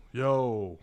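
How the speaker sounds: ordinary talk, nothing marked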